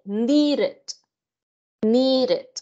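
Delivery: needed with an extra syllable, ni-ret, said twice; ied ending demo. Shown as a demonstration. Teacher drilling needed for the class, extra syllable. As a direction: stretch needed into two clear syllables. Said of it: "que es I E D".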